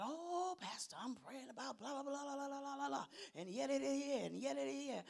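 soft, rattled-off blah-blah; vague praying churchgoer. Acted. Churchgoer telling pastor what they pray about, vague babble. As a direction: rattle off empty prayer talk softly. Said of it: "tap people on the shoulder and say".

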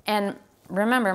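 ordinary delivery

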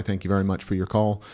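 ordinary talk, nothing marked

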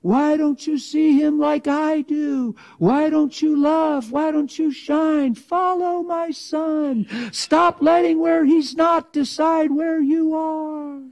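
God answering the complainer, slow and high. Acted. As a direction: speak as God, calmly urging. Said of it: "you hear God saying".

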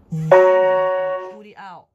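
drawn-out strained shout. Acted.

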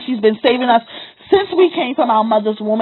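usual speaking voice

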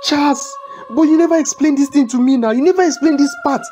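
high, distressed pleading; alarmed, near-tearful questioning; panicked protest. Acted. Learns he is to die.

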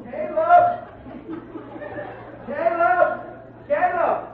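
put-on high hollering calls for a lost child; men hollering. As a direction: call into the dark woods, searching. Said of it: "heard what they was hollering".

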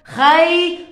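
خيط said loud, with the ط clearly at the end; model word repeated. Shown as a demonstration. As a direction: repeat خيط loudly so the children hear the ط. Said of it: "أنا وين لفظت القط؟".

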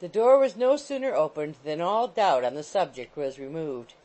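ordinary delivery, nothing marked

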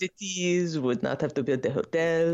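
mock French-accented official. Co-host joins in imitating the IOC president, French accent. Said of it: "It is French, isn't it?".

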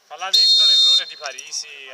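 loud, breathless goal shout; excited goal call. Acted. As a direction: shout it as the ball goes in. Commentator calling a goal.

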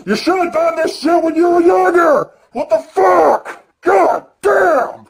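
high, exasperated outburst; fed-up fan yelling at his hero; angry rant. Real, not acted. Ranting at Sting's late debut.

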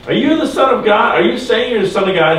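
high-pitched retold interrogation; accusing question. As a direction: press the question like an accusing priest. Priests interrogating Jesus, acted out.

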